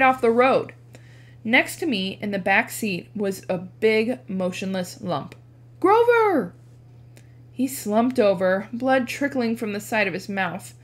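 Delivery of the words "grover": a high, loud cry of a friend's name; alarmed shout. Acted. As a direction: shout his name in panic. Waking his injured friend.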